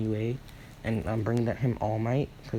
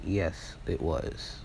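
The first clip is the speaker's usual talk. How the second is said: low, hushed villain-like confirmation; deep dramatic voice; 5 semitones lower, 5 dB quieter, much slower than usual. Acted. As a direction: drop the voice low for the reveal.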